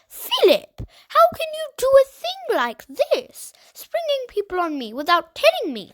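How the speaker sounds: high, loud sharp scolding; sharply. A narrator's reading. Aunt scolding Philip for bringing unexpected guests, sharp. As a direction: snap at the boy for springing guests. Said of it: "said Aunt Polly sharply".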